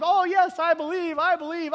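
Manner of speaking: high, eager mock believer; imitating over-eager agreement; glib profession. Mimics Martha's too-easy profession.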